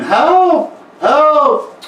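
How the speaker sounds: high, loud cries for help; little boy screaming for help. Acted. Game master voicing the trapped boy crying help. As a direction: call out like a trapped kid. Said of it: "you hear screaming".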